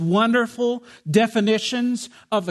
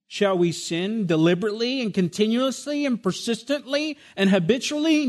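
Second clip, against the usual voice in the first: rising, pressing question; building question. Shown as a demonstration. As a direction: build each word toward the answer.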